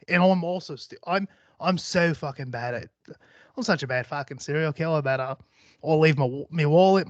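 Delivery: amateur put-on Australian accent. Shown as a demonstration. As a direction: tell it casually in a hammed Australian accent. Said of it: "try to get a little Australian".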